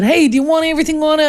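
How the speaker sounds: high, quick New York street-vendor call; New York vendor accent. Retold or imitated. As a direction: imitate a New York hot dog seller. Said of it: "And the guy said".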